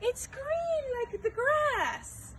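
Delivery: high excited cry; excited exclaim. Acted. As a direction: burst out with delight. Excited answer to kids.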